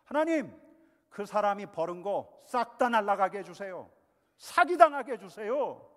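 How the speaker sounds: shouting, high and very loud; furious cursing prayer. Pretend. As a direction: cry out to God in rage. (하나님께 분노를 쏟아내며 외치듯)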